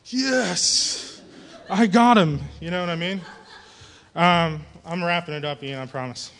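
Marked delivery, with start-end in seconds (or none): 0.1-2.4 triumphant laugh. Retold or imitated. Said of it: "And you're like".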